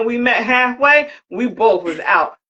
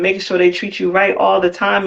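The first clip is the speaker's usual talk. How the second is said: ordinary talk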